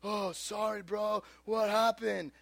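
soft, hushed buddy voice; imitated sympathetic friends. Friends to Scott after truck vanished, hushed sympathy.

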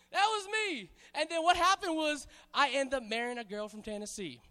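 ordinary talk, nothing marked

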